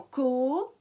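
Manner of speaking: "co" slowly, with a long O; held O. Shown as a demonstration. Teacher proving O in second syllable, vowel held. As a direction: draw out the vowel in "co". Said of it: "on entend bien O".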